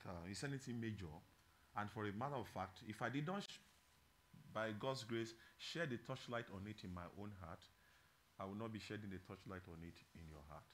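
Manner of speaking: soft, low, earnest preaching; quiet confession. Real, not acted. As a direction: confide it gently to the congregation. Quiet personal confession in sermon.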